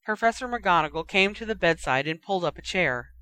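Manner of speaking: ordinary delivery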